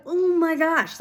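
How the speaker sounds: high, drawn-out astonishment; amazed gasp-like exclamation. Retold or imitated. Witness reliving a blind woman's healing, amazed exclamation. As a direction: relive the awe, pitched well up.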